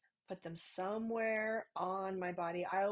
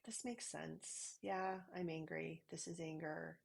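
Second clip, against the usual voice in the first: quiet tender reassurance to oneself; soothing self-talk; 2 semitones lower, 8 dB quieter than usual. Shown as a demonstration. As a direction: comfort yourself gently. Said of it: "I start to say things like".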